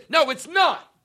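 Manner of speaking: slap the objection down, voice way up; exasperated rebuttal. Acted. Ranter answering the imagined objector, exasperated.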